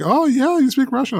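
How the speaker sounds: high mock surprised girl voice; imitating Russian girl. Cohost retells a Russian girl at a conference, high surprised voice. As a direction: quote her high and bright with surprise. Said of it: "she was like".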